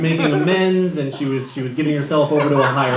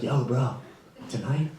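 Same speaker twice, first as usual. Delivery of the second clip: lower, soft conspiratorial tip-off; hushed buddy; 3 semitones lower, 9 dB quieter than usual. Retold or imitated. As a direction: lean in and whisper the secret. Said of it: "My buddy was like".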